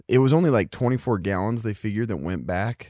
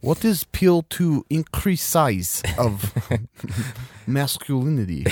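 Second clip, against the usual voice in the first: put-on Russian accent. Russian accent bit continues.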